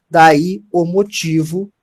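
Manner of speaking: emphasize "motivo" to show it is the implied word; stress on motivo. Shown as a demonstration. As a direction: draw the words out to show the hidden word. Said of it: "quando subentende a palavra motivo".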